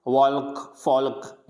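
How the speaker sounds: वॉल्क and फॉल्क with an audible l; वॉल्क, फॉल्क, silent L wrongly pronounced; sounded L. Shown as a demonstration. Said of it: "ऐसे नहीं पढ़ेंगे".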